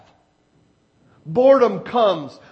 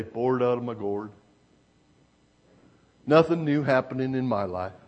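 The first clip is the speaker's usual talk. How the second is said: acted boredom; 8 semitones lower, 4 dB quieter, faster than usual. Acts out a bored person.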